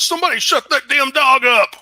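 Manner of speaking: loud, high-pitched angry yell. Acted. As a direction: yell it down the hall at full voice. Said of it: "you hear a man yell out".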